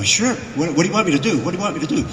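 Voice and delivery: acted-out eagerness on the phone; eager agreement. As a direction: jump at the offer like a hungry actor. Eager actor answering his boss.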